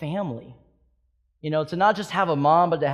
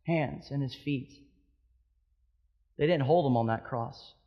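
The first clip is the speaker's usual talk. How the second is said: quiet, hushed pastoral appeal; a pastor softly voicing God's promise to the hurting; soft altar-call voice; 2 semitones lower, 5 dB quieter than usual. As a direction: draw them in gently, almost confiding.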